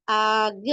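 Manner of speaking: long held aa in आग, then g; stretched alif madd. Shown as a demonstration. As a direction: draw out the opening vowel of आग. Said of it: "खींचना है".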